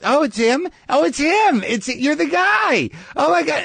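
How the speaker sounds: high, gushing starstruck fan voice; excited fan woman. Retold or imitated. Woman outside spotting the comedian, gushing high. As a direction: squeal it like a fan who just spotted you. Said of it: "I hear this woman look at me and goes".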